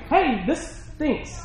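slow, high, drawn-out complaining; whiny complainer. Retold or imitated. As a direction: moan about the problem with no fix. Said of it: "You ever had that person come to you".

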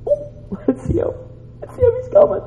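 high, hushed squeal of hope; thin, high, breath-held excitement; excited anticipation. Acted. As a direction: spot someone far off, barely containing it. Father spots returning son.

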